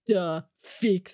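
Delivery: terse mock Belarusian accent; heavy Belarusian accent, word by word. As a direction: imitate a Belarusian coworker reporting in. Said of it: "this really strong, like, Belarusian accent".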